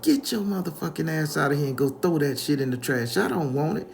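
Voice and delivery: high-pitched angry scolding; acted outrage, voice up high; irate grandma. Angry grandmother slams the door.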